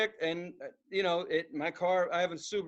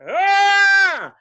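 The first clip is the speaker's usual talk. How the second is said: high, loud yell; mock yell; 11 semitones higher, 13 dB louder than usual. Driver yelling as the car hits the helmet. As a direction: yell on impact.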